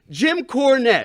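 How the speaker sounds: Jim Cornette. Acted. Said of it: "he reminds me of Jim Cornette".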